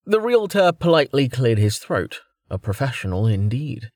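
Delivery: ordinary delivery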